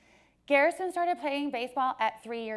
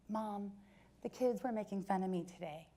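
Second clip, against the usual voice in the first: put-on sad little boy; 10 dB quieter than usual. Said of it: "this little baby was really sad".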